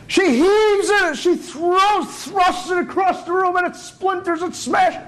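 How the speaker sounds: high, straining action telling; dramatic shouted narration. Retold or imitated. Storyteller reenacting the wife hurling the esrog, high and frantic. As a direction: heave the verbs out high, stretching the first. Narrating the furious throw and smash.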